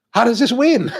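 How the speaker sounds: can't believe this won; mock outraged disbelief; incredulous. Incredulous quote at competition result.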